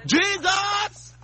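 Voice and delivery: high shouted Jesus; high call of Jesus. Real, not acted. Shouting the name.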